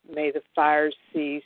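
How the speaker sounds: ordinary talk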